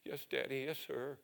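trembling lips. Retold or imitated. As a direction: answer meekly, holding back tears. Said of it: "with trembling lips".

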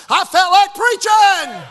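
high, loud, drawn-out declaration; exultant shout. Real, not acted. Preacher tells congregation how prayer revived him, exultant shout. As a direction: shout it out triumphantly. Climax of his story, exultant.

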